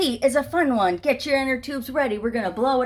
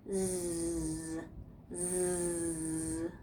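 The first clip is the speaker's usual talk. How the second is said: soft, lower zzz; sleepy snake buzz; 4 semitones lower, 10 dB quieter than usual. Acted. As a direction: buzz softly like basking snakes. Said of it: "they said".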